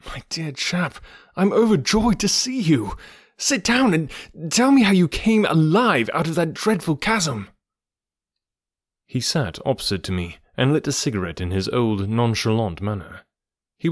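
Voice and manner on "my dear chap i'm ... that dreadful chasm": high, eager delight; overjoyed. A narrator's reading. Man urges returned friend to tell his escape, overjoyed. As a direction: welcome him with excited relief, pitched high.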